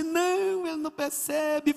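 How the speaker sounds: high, drawn-out mock crying; imitated tearful wail. Weeping wife admits to pastor her husband doesn't notice, mock wail. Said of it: "ela que chorou mais ainda".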